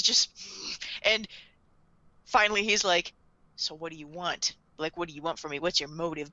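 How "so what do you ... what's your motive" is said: low, soft interrogation voice; suspicious questioner. Retold or imitated. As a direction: imitate a guarded man demanding answers, quietly. Acting Light questioning Misa.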